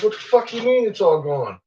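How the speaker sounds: high, indignant pet voice; voicing the pet. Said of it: "It's like".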